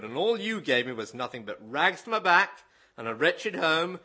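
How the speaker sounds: a lightly put-on Cornish accent, loud and lively; preacher reading Billy Bray in a rough Cornish accent. Preacher to congregation, reading Billy Bray's story in a hammed-up Cornish accent. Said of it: "apologies for not quite getting the Cornish accent".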